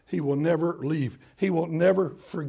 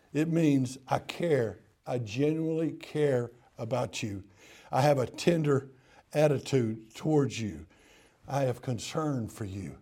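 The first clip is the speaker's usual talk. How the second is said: softer, slightly lower, with lingering pauses; tender reassurance; 3 semitones lower, 3 dB quieter than usual. Acted. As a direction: let each phrase land gently and sincerely. Voicing God's tender care.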